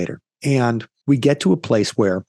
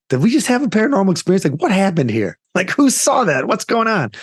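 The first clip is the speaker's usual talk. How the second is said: higher, rising amazed questions; stunned disbelief; 8 semitones higher than usual. Real, not acted. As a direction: ask in breathless astonishment. Stunned reaction after paranormal moment.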